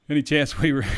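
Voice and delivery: amused, half-laughing read; laughing while reading. Real, not acted. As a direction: giggle through the question. Laughing through a fan question.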